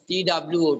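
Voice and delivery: letters of "two" spelled one by one; spelling out. Shown as a demonstration. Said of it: "spells out the word two".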